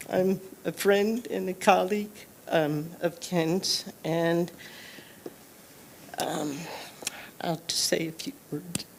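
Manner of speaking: soft, halting, near whisper; barely voiced, holding back emotion; faltering tender eulogy. Real, not acted. Nervous start of memorial tribute.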